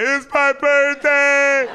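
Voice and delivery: very high, loud, stretched-out comic bawling; mock wail. Teen put in cop car, wailing inside, mock wail. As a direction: hold each word in a hammed-up wail.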